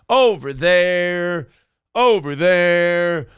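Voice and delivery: slow, drawn-out spooky warning; lightly hammed-up dread; mock ominous. Host mocking Philly, slow ominous warning voice.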